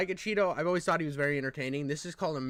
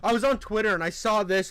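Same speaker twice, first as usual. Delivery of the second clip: ordinary talk, nothing marked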